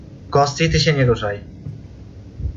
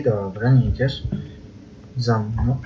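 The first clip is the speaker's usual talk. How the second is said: ordinary talk, nothing marked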